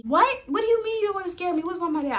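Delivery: high, loud, rushed alarm; retold panicked outburst; panicking. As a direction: demand answers in rising panic. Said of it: "I'm panicking at this point".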